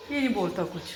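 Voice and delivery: ordinary talk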